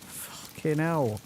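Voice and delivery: slow, stretched-out relieved curse; drawn-out fucking hell. Real, not acted. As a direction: let it out long and slow after the scare. Recovering from a jump scare.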